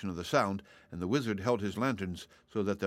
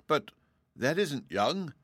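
higher, startled protest; amazed; 7 semitones higher, slower than usual. A narrator's reading. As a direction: blurt it out in disbelief. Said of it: "cried Dorothy in amazement".

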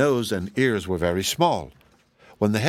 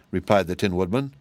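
plain reading voice

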